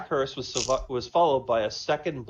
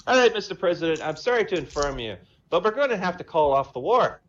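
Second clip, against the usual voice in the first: higher, louder Irish brogue; Irish accent; 4 semitones higher, 6 dB louder than usual. Acted. Paddy the Irishman phoning the president, Irish accent. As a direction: play a cocky Irish pub man. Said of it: "Irish and Jamaican".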